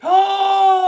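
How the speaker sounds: big loud high gasp; theatrical sharp intake of breath. Acted. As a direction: gasp out loud in mock shock. Reacting to child's news.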